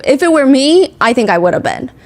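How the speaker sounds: ordinary talk, nothing marked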